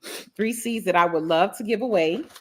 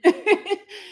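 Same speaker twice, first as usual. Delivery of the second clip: teary laugh; 13 semitones higher, 4 dB louder than usual. Real, not acted. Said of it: "I'm trying not to start crying again".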